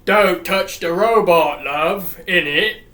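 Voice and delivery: lightly voiced stern father, slow and loud; a slow, hammed-up warning; William. Father warning his daughter off the robot, in a slowed put-on voice. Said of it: "And William's like".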